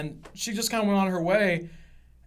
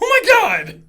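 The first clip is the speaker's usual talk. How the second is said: loud, high mock exclamation; hammed-up horrified outburst; aghast; 14 semitones higher, 9 dB louder than usual. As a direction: cry out in exaggerated shock. Retold shocked reaction to customer.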